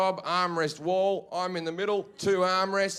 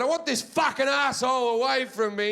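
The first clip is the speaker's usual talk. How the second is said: angry complaint; 5 semitones higher than usual. Retold or imitated. Acted complaint to flight attendant.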